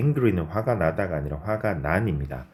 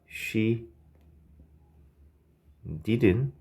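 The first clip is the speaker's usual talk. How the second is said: slow dictation; 2 semitones higher, 3 dB quieter, much slower than usual. Shown as a demonstration. Teacher dictating the tag question slowly to students. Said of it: "라고 해주시면 되겠습니다".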